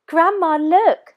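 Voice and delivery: high and loud, eager; excited. A narrator's reading. Moana excitedly shows grandma the hatching nest. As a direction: call grandma over, thrilled. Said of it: "she said excitedly".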